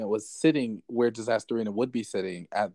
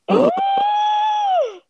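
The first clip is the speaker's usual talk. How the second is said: sharp high gasp of delight; shocked gasp; 7 dB louder than usual. Real, not acted. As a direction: gasp at juicy news. Reacting to new gossip.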